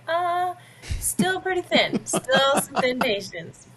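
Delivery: high, drawn-out hesitant verdict; office doubters imitated. Office staff judging her patience, high teasing doubt. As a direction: pitch it way up, teasingly skeptical. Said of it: "people in the office would say".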